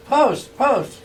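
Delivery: loud, high shout for Post; calling out. Retold or imitated. Waking up naked, calling for his friend: loud, high shout. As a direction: yell the name across the house, high and urgent.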